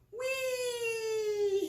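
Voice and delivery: high, joyful cry; gleeful whee. Acted. Pig sliding down the giraffe's neck, squealing whee. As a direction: slide down squealing with joy. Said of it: "he cries".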